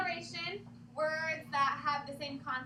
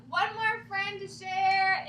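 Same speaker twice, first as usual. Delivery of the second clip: loud and slow; 4 semitones higher, 10 dB louder, much slower than usual. Real, not acted. Calling the class back.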